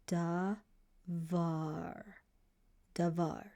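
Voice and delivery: "d, ah ... davar" sounded out syllable by syllable; sounding out. Shown as a demonstration. Teacher sounding out the word davar for students. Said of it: "pronounce it this way".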